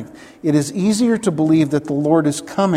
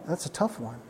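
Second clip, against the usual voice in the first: much softer, almost to himself; quiet repeat; 3 semitones lower, 12 dB quieter than usual. Real, not acted. Preacher repeats the hard truth softly to himself, quiet. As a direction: let it drop away, half under the breath.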